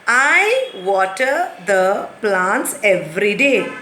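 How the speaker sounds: slow, clear model reading; slow model sentence. Shown as a demonstration. Teacher slowly reads the corrected sentence. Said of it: "corrected sentence".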